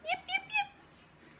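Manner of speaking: very high, slow yapping pup; puppy yips. Acted. Teacher reading farm book, very high slow puppy yips. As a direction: yip like a tiny puppy.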